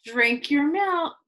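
ordinary delivery, nothing marked

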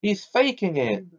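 furious. A narrator's reading. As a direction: jab the accusation out in anger. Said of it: "said Harry furiously".

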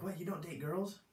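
high-pitched, rising incredulous question; mock surprise at the answer; imitated disbelief. Youths' incredulous question, retold.

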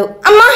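sudden high shout of pain; cry of pain. A narrator's reading. Wife cries out in pain in the kitchen, sharp high shout. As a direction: yelp as hot oil hits her hand. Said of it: "విజయ అరవడం విని".

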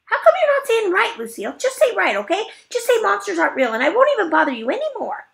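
loud whiny insisting; high, loud demanding. Acted. Junie B desperately demands Lucille say monsters aren't real, high and loud.

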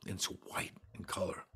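whisper. Real, not acted. Whispered aside about the drawing.

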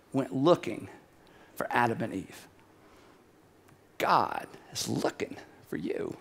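hushed confiding whisper; hushed, intimate. Real, not acted. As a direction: drop low and soft, almost a whisper. Preacher drops to hush.

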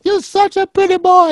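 high, loud doting Italian granny; grandmother voice. Retold or imitated. Grandma cooing over her grandson, high doting granny voice. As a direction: squeal it like a doting old grandma pinching cheeks. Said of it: "She'd go".

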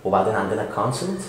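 ordinary delivery

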